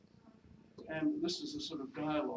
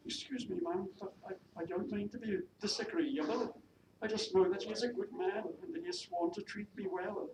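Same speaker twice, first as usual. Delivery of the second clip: higher-pitched Welsh maid, unraised; attempted Welsh accent; 7 semitones higher than usual. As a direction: defend your sweetheart in a Welsh accent. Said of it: "my attempt at a Welsh accent".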